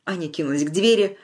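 plain reading voice

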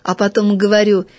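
ordinary delivery, nothing marked

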